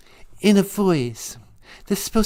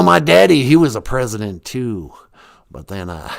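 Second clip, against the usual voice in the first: put-on low, smug good-old-boy president; George Bush; 14 semitones lower than usual. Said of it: "And I can do George Bush".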